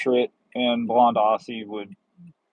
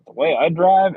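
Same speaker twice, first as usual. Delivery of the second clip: boastful; 6 semitones higher, 8 dB louder than usual. Real, not acted. Joking about own driving.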